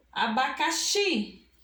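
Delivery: "Abacaxi" drawn out, weight on the final xi; teacher's slow stress on the end of "Abacaxi"; stressed last syllable. Shown as a demonstration. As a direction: say "Abacaxi" slowly, leaning hard on xi. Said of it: "pronunciamos com mais força".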